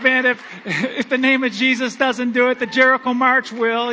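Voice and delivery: loud, excited prayer through laughter; laughing prayer. Retold or imitated. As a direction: pray it half laughing at yourself. Retold prayer at truck.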